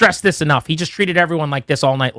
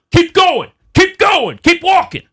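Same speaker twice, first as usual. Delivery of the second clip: much higher, louder mock yell, repeated; imitating referee yelling; 13 semitones higher, 4 dB louder, slower than usual. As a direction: shout him off the field like the referee. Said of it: "But he's like".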